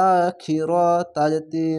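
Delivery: ordinary talk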